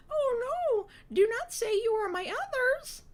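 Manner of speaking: very high, loud put-on character voice; Shiraz's dismayed outcry. A narrator's reading. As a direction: cry out in a piercing, theatrical falsetto. Alarmed Shiraz, in her put-on voice.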